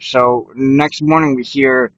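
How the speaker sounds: normal talking voice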